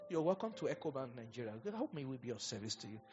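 put-on soft, polite bank greeting in an English accent. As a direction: greet a customer quietly, as if born in England. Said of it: "speak like you are born in England".

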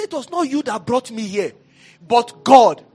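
rising from firm to shouting; building to a shout. Real, not acted. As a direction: build it up until you shout. Preacher leads proclamation, building up.

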